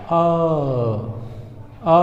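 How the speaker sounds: long, held आ; आ as one sustained open "aah"; drawn-out vowel. Shown as a demonstration. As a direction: stretch आ out like a teacher demonstrating. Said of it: "थोड़ा सा ज़्यादा खींचेगा".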